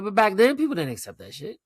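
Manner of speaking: ordinary talk, nothing marked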